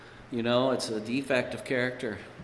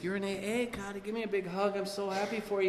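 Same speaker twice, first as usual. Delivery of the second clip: gushing friend; 9 semitones higher, 5 dB quieter than usual. Retold or imitated. Don gushing congratulations.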